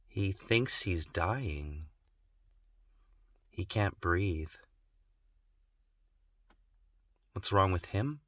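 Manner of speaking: very soft, slow, voice nearly gone; broken whisper. Real, not acted. Son replaying the night his father spoke up, hushed and broken. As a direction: let the words barely come out through grief.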